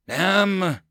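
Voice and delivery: high sharp curse; cursing. Acted. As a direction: spit out the curse. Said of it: "Amaj cursed".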